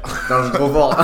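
loud, high laughing brag. Real, not acted. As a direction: laugh it out, proud of yourself. Laughs at guessing the age.

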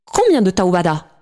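understated exclamation from a narrator; cried out. A narrator's reading. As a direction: call the question out. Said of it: "J'ai crié".

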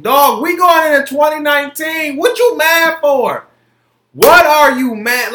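high, loud exasperated yell; angry shout. Real, not acted. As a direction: yell at them, fed up. Angry rant peak.

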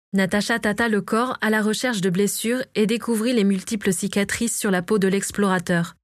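usual speaking voice

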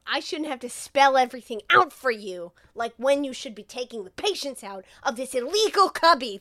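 loud, exasperated telling-off; mock scolding. Doctor blames colleague over the patient, mock scolding. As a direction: punch out the acted reprimand.

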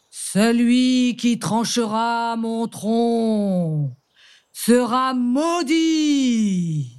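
slow, booming tree voice; cavernous voice. Acted. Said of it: "D'une voix caverneuse".

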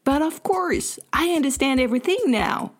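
high, delighted English-speaking neighbour; bright high-pitched relief; beaming realisation. A narrator's reading. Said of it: "Il me décoche un grand sourire".

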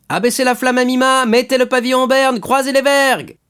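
high, loud, sustained calls across the deck; shouted commands. Acted. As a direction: call out orders to the sailors. Orders called out to sailors. (ordres criés aux matelots)

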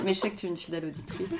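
usual speaking voice

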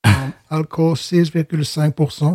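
a hint of an English accent on the French label; lightly put-on English accent. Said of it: "je prends l'accent anglais". (léger accent anglais)

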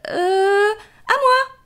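high timid gazelle. Acted. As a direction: squeak it out high. Gazelle speaks.

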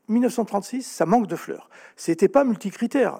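normal talking voice

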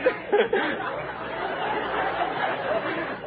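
breathy laugh. Real, not acted. Laughing mid line.

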